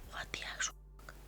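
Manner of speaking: barely audible quick whisper; hushed, voiceless bewilderment; whispered disbelief. Retold or imitated. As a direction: whisper a stunned curse under your breath. Bewildered reaction, told in whisper.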